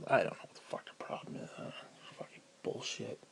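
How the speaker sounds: real frustration, low and nearly whispered; exasperated mutter. Real, not acted. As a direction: grumble it under your breath. Venting under breath about the bills.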